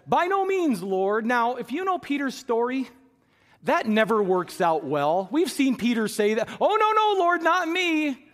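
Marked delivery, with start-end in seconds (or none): 0.1-1.2 high, loud jump on no, protesting; Peter protesting. Acted. Peter refusing the Lord, high sharp protest. 6.6-8.3 high, much louder, slow pleading no no; imitating Peter's refusal. Preacher mimics Peter dodging the Lord, high mock pleading.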